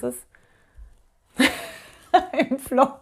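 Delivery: chuckling through im Flow; amused, laughing as it lands. Real, not acted. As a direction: let a laugh break into the words. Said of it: "Ich muss grad lachen".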